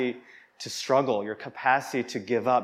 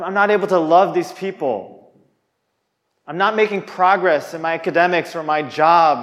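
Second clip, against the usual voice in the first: anguished complaint; 5 semitones higher, 5 dB louder than usual. Acted. Acted believer complaining to God.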